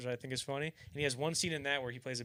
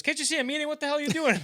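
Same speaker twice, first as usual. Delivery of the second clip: high, loud mock outburst; hammed-up irritated yell; 14 semitones higher, 10 dB louder, faster than usual. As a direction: snap at a friend who interrupts your dinner. Voices [name] snapping at [name].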